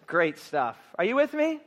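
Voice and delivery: usual speaking voice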